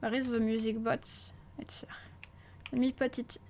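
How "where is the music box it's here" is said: unvoiced hush; whispering. Real, not acted. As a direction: whisper it to yourself. Whispering while searching.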